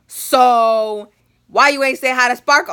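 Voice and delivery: loud, accusing mom voice; mock scolding mom. Mom confronts daughter about not saying hi, loud scolding. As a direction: confront them loudly, imitating mom.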